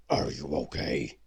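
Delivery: a big beast checking on her; beast asking. Acted. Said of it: "he asked".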